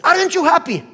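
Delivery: loud, high-pitched challenge; acting out an exasperated son; incredulous demand. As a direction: demand it, voice leaping up in disbelief. Son baffled by dad's quiet reaction.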